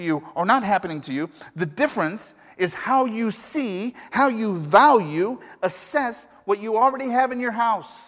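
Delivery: rising to the climax of the sermon; preaching at full force. Acted. Pastor drives the point home.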